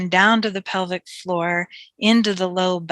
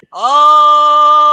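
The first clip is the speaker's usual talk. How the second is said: long, loud, high open ahh; saying ahh; 7 semitones higher, 12 dB louder than usual. Shown as a demonstration. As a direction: let out a big open ahh. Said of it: "we'll do it again".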